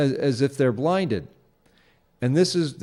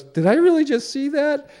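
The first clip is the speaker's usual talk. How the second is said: pitched way up in disbelief; a much higher, stunned 'did that happen' voice; amazed lady; 13 semitones higher, 3 dB louder than usual. Retold or imitated. Lady seeing a squirrel wave, voiced much higher in amazement. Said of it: "and the lady goes".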